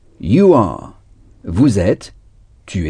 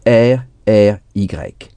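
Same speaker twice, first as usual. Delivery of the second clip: slow spelling; slower than usual. Shown as a demonstration. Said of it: "Sorry s'écrit S O".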